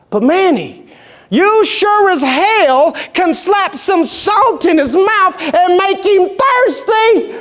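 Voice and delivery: high, slow, emphatic East Texan cowboy; East Texan accent. Acted. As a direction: stretch it out like an East Texan cowboy making his point. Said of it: "in this rich East Texan accent".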